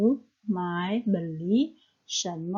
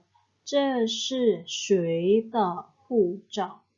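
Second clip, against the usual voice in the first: slow, careful Mandarin model read; slow model sentence; much slower than usual. Shown as a demonstration. Teacher reading an example sentence to students, slow and careful. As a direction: spell it out slowly for learners. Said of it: "Contohnya kita lihat".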